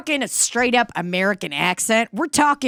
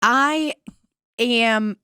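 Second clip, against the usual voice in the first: ordinary delivery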